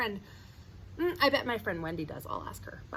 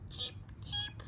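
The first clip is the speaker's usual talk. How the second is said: very high, soft cheeping; sparrow cheep; 10 dB quieter than usual. Acted. Reading sparrows' chirp to kids, very high soft. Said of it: "The sparrows chirped".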